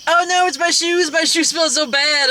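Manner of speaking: high, loud put-on whine about her shoes; mock whiny mom. Mom frets loudly to her children about smelly shoes. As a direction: ham up a fretting mom. Voicing the mother in movie.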